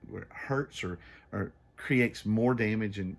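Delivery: ordinary talk, nothing marked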